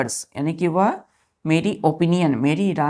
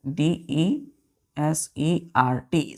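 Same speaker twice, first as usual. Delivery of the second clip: very slow, drawn-out dictation; much slower than usual. Shown as a demonstration. Teacher dictating the sentence slowly. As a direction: drag the words out for writing. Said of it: "single S".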